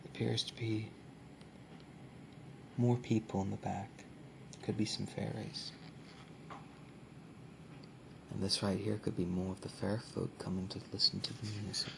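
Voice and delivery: hushed, close soft-spoken ASMR. Acted. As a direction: speak softly, as if close to the mic. ASMR book showing, soft spoken.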